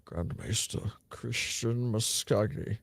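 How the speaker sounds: slow, soft grand title; grand announcer voice. Acted. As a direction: announce it like a herald.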